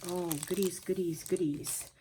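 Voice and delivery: ordinary delivery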